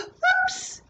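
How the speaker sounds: sudden gasp then a very high whoops; surprised whoops. A narrator's reading. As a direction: catch the fall with a squeal. Skier falls in story.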